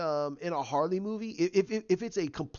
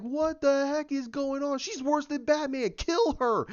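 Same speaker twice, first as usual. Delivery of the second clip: Penguin exasperated; 10 semitones higher, 3 dB louder than usual. Retold or imitated. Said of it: "He's being like".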